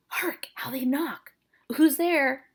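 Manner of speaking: hush, then call out to the knocker; alarmed friar. Acted. Friar startled by knocking at his door, urgent.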